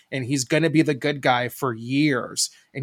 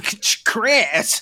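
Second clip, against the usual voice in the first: Michigan accent; 8 semitones higher, 4 dB louder than usual. Shown as a demonstration. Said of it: "our shitty Michigan accents".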